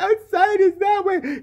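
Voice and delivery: very high, slow, dazed voice; mock timid heroine. Mocking the heroine pointing outside, high and slow. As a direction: stretch it out high and lost.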